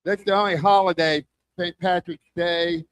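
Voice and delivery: ordinary talk, nothing marked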